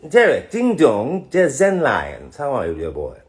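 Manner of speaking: high, slow, loud sing-song greeting; hammed-up greeting. Actor greets a fan on stream, high and slow. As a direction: sing out the name like a showman.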